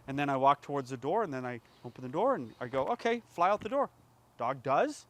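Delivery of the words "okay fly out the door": cheerful release cue. Retold or imitated. Cue to dog.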